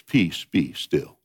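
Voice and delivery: low, slow and soft, calming the storm; calm command. Retold or imitated. Jesus on the boat deck calming the storm, low and slow. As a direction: speak it slow and low to still the sea. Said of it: "he just says three words".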